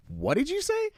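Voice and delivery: high, startled disbelief; stunned question. Retold or imitated. Fireworks seller stunned by rude worker, high startled question. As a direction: pitch up in shock at the insult. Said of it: "it flummoxed me".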